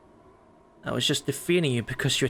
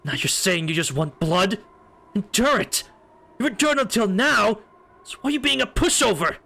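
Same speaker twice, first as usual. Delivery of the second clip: angry scolding; 7 semitones higher than usual. Acted. Scolding Shion loudly.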